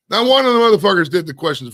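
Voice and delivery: really venting at the lazy friends; furious outburst; pissed off. Real, not acted. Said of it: "Pissed me off".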